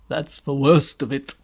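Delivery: loud, groaning faun; deep groan. Acted. Faun answering Lucy's question, groaning. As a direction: groan the words out in misery. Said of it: "said Mister Tumnus with a deep groan".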